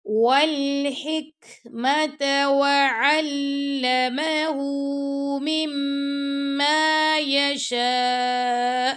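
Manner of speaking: slow, melodic tajweed chant; teacher chanting a verse for students; Quran recitation. Shown as a demonstration. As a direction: recite it slowly with full tajweed.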